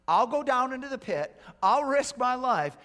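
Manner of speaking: loud, high, emphatic declaration; impassioned plea. Retold or imitated. Missionary pleading to his missions committee, impassioned. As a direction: proclaim it as a bold vow.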